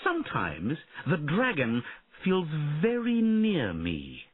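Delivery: put-on a boy puzzling aloud to his mother; young prince voice. A narrator's reading.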